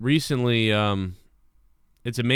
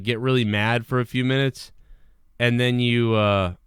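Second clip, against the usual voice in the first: normal talking voice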